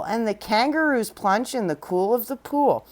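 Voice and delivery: ordinary delivery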